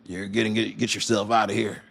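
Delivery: a hint of a put-on Texan as China; lightly voiced Texan bouncer; mild put-on country voice; Chinese Texan voice. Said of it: "this is a Chinese, uh, Texan".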